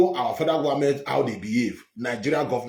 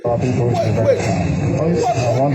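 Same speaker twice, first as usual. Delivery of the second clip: laughing; 4 semitones lower, 6 dB louder, much slower than usual. Real, not acted. Laughs at the absurd claim.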